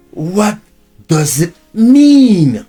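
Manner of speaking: usual speaking voice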